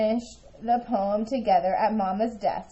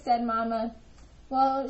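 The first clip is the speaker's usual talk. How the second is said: usual speaking voice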